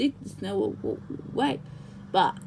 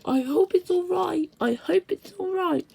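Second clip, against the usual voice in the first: worried hushed voice; anxious whisper; 7 semitones higher than usual. A narrator's reading. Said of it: "they whispered anxiously to each other".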